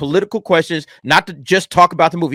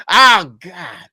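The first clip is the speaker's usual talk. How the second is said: usual speaking voice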